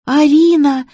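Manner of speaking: high, loud delighted squeal; squealing childlike girl. A narrator's reading. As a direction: squeal the name like a little girl. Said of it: "запищала сотрудница банка".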